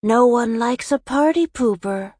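high, reedy villain taunt; poor imitation of a chilling clown villain, pitched high; Smiles. A narrator's reading. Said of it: "his voice high and reedy".

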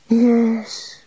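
high, weary sigh of a yes. A narrator's reading. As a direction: give in with a sigh. God sighed.

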